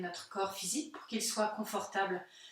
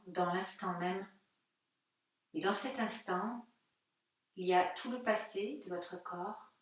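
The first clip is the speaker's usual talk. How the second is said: calm, unhurried guided-meditation voice with long pauses. Real, not acted. Yoga teacher guiding listeners' breathing into silence, calm meditative delivery. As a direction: guide listeners gently inward, leaving space between phrases.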